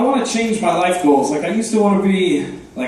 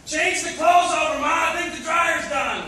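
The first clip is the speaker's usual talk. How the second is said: mock yell; 11 semitones higher, 6 dB quieter than usual. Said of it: "would just yell at her mom".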